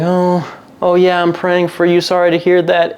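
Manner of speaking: lightly voiced older lady consoling; a hint of a pious friend; mock sympathetic friend. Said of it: "the friend was like".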